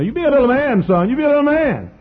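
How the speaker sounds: very high, loud mama voice; hammed-up shrieking motherly pep talk; imitating his mother. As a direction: urge the boy on over his fear. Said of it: "how you used to say to me".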